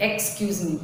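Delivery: normal talking voice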